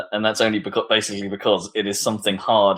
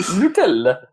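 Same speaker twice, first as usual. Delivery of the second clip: put-on Italian accent; 6 semitones higher, slower than usual. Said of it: "say it with a dull Italian accent".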